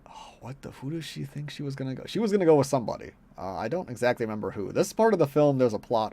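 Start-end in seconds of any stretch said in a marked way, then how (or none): 0.0-2.0 hushed thinking aloud, under the breath; muttering to self. Real, not acted. Reviewer racking their memory over a film plot, muttering. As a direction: mumble it softly while trying to remember.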